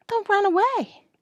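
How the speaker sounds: put-on very high, thin-volume child voice; young boy calling a caution; worried boy's warning. A narrator's reading. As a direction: plead in a high little voice. Pierre voiced as nervous boy.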